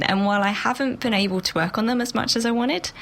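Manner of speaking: ordinary delivery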